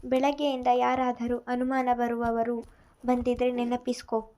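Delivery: usual speaking voice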